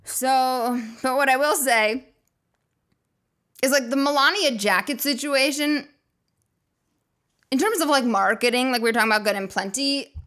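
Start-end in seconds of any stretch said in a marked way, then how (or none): none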